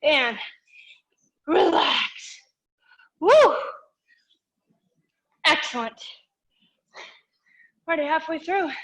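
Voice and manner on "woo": a high, loud whoop of relief; excited cheer. Real, not acted. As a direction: cheer as the round ends. Instructor cheering after interval ends.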